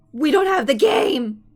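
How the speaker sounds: slow, loud panicked yell; panicked shout. Retold or imitated. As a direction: cry out in mock panic.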